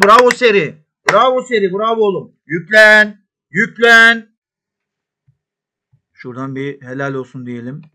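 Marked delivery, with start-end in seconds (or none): 0.0-4.4 loud, high praise shouted at the screen; coaching from the touchline, shouting; cheering. Real, not acted. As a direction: egg the players on. Cheering players.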